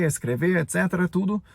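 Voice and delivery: ordinary talk, nothing marked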